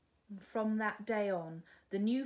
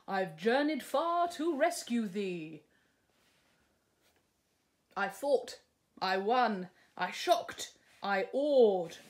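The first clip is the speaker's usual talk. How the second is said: louder, swaggering prince voice; a lightly voiced pompous prince; boastful prince; 3 semitones higher, 6 dB louder than usual. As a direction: puff up like a showing-off hero. Prince character voice.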